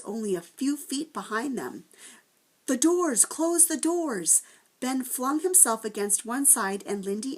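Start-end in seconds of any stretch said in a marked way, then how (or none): 2.7-4.4 higher and louder, close stretched out slowly; urgent shout. A narrator's reading. Children shout to shut the gates on the monster, urgent and loud. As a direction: bark the order in a panic.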